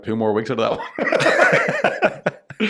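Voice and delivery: deliberate conspiratorial drawl; slow sly aside. Retold or imitated. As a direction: drag it out to your sister.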